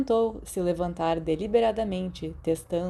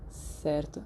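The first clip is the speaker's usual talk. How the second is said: soft, awkward mumble; awkward murmur; 3 semitones lower, 8 dB quieter than usual. Acted. Remus answering Sirius awkwardly, mumbled and soft. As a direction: say it without looking at him.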